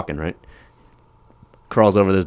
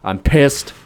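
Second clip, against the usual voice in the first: raised ranting voice; mock tirade; 6 semitones higher, 3 dB louder than usual. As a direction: vent at the world. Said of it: "He's like".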